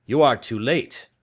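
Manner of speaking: loud, urgent and dismayed; alarmed cry. A narrator's reading. Watson tells Holmes the news, loud and alarmed. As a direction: blurt the bad news loudly. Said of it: "I cried".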